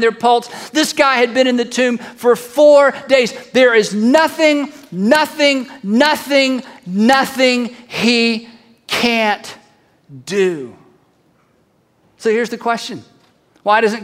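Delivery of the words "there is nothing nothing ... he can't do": "nothing" hit hard each time, each one set apart; emphatic declaration. Real, not acted. Preacher to congregation after the miracle, emphatic spaced "nothing". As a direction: punch every "nothing", then stretch out "he can't" and let "do" drop.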